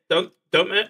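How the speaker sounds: usual speaking voice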